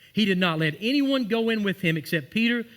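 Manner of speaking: ordinary talk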